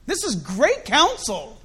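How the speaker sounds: usual speaking voice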